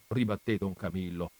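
usual speaking voice

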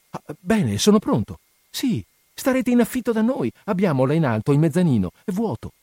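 high-pitched, delighted planning; eager, excited lovers. A narrator's reading. Two lovers planning his move into her house, excited and eager. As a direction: jump at the idea eagerly.

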